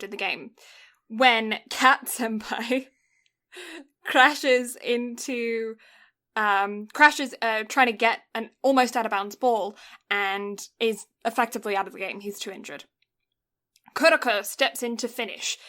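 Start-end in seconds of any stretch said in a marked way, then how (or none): none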